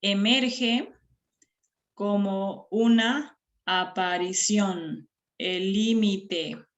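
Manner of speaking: slow, syllable by syllable; deliberate, segmented line of verse; counting syllables. Shown as a demonstration. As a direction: space out every syllable for pupils to count. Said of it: "hemos contabilizado las sílabas".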